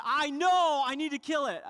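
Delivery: wife snapping at her husband over the phone; angry wife. Retold or imitated. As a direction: fire it back, fed up with him. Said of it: "some anger".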